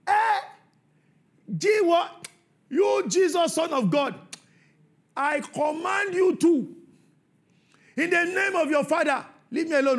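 high, loud mocking demon retort; defiant demon. The unclean spirit talking back to Jesus, loud and defiant. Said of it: "the unclean spirit responded to Jesus".

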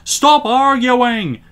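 high, loud, drawn-out complaint; grumbling passengers. A narrator's reading. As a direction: cut in on the squabble, fed up. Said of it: "grumbled the passengers".

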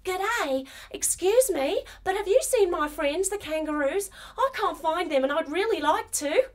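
high-pitched kangaroo voice; little kangaroo. A narrator's reading. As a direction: ask politely in a high little voice. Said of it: "she hops right up to the snake and says".

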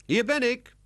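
put-on very high, loud child's reply; squeaky called-out answer. A narrator's reading. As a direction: pipe up from afar. Lionel answers mother's call.

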